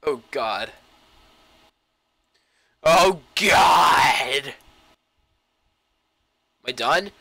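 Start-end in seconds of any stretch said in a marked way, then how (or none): 2.8-4.5 loud high cry of fright; startled shout. Real, not acted. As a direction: yell as it falls. Another sudden drop.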